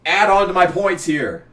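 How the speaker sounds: normal talking voice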